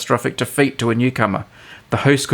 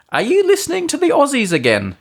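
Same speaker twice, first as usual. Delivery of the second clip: high, lightly voiced wife's question. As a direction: ask it as his puzzled wife. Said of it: "she will ask".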